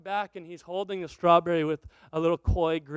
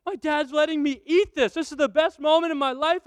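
put-on higher, eager kid voice; thrilled little boy; 6 semitones higher, 4 dB louder than usual. Son thrilled dad lets him eat the strawberry, higher eager voice. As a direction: play the overjoyed son. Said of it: "He thinks".

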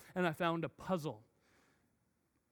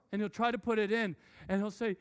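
ordinary talk, nothing marked

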